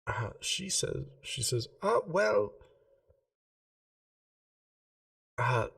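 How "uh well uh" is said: a lightly voiced, slightly higher shopkeeper, polite and earnest; a hint of a prim gem-shop lady, keen on geometry. [name] offers to name stone.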